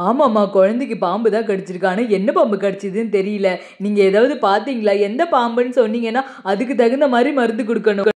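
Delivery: put-on a concerned young healer asking which snake; village healer's son.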